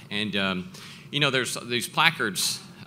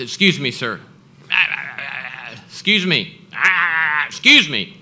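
polite call growing louder each time; calling out, building louder; 4 semitones higher, 8 dB louder than usual. Retold or imitated. Calling to the man on the phone, building from polite to loud. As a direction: raise the voice to cut in, louder each try.

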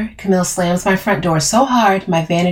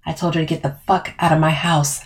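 ordinary delivery

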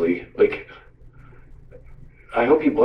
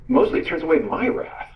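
"my" pushed up higher against "their"; stress on MY; 7 semitones higher than usual. Real, not acted. Preacher corrects whose wrath it is, stress on "my". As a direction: lean on "my" to turn the point inward.

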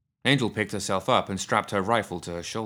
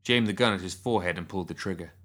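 ordinary delivery

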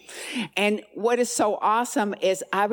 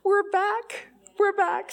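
teary joy; 11 semitones higher, slower than usual. Retold or imitated. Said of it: "I got all teary".

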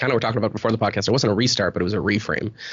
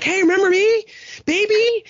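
much higher and louder, a mock mom calling out; 4 dB louder than usual. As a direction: mom in labour asking not to be forgotten, hammed-up and high. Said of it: "My mom's like".

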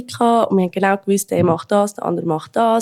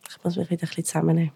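imitating his contrite muttering, much softer; half-whispered, lower mock admission to oneself; sheepish inner voice; 3 semitones lower, 9 dB quieter, faster than usual. Partner voices his thoughts after an outburst, quietly sheepish. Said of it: "nachher denkt er so".

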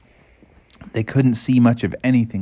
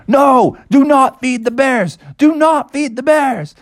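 high, urgent hollered warning; frantic high-pitched command; shouting park ranger; 13 semitones higher, 3 dB louder than usual. Acted. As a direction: shout orders in a high cartoon voice. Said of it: "park rangers started shouting out".

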